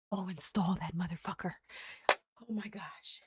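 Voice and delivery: hushed whispered aside; sneaky little whisper to the download. Real, not acted. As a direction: whisper it under your breath.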